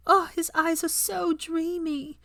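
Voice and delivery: high, slow, lovestruck sigh; swooning schoolgirl. Acted. Student swooning over Harry in class, high and slow. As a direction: melt over the dreamy boy.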